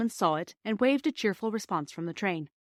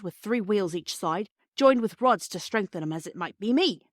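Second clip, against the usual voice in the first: a chatty Cockney railway porter, eager to explain. Acted. Perks the porter explaining engines to the children, in hearty Cockney. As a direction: lay on the Cockney, friendly and proud of his engines.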